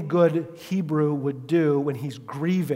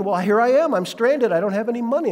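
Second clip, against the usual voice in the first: higher, louder plaintive complaint; traveler's plea; 6 semitones higher, 4 dB louder than usual. Retold or imitated. Stranded traveler pleading with the pastor, lightly whiny. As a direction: sound hard done by. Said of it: "they're like".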